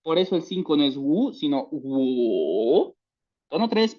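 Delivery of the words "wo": "wo" very slow, low, dipping and rising. Shown as a demonstration. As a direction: drag the "wo" down then up. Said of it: "primero baja y luego sube".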